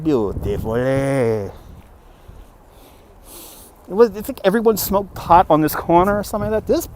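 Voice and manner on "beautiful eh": low put-on Italian accent. Shown as a demonstration. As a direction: savor it in a deep mock Italian accent. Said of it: "An Italian accent is easy".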